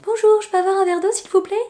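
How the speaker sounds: high, dazed polite voice; polite spaced-out request. Retold or imitated. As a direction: ask sweetly as if out of it. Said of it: "je leur ai fait". (petite voix polie et planante, aiguë)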